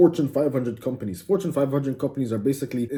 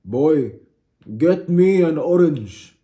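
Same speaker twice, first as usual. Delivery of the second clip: loud, high, drawn-out order; hammed-up, forceful command; insistent demand; 4 semitones higher, 6 dB louder, much slower than usual. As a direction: boom it out slowly like a comic tyrant. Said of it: "I look at you and I say".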